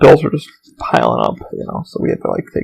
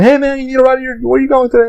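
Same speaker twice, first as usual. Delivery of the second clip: higher, upbeat buddy voice; friendly tech guy; 9 semitones higher than usual. Retold or imitated. Tech in a golf cart hailing a passing friend, cheery and higher. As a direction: call out cheerfully like a pal offering a lift.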